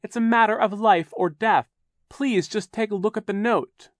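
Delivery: put-on high, loud, urgent child; begging. A narrator's reading. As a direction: plead as if lives depend on it. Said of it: "Violet begged Mister Poe".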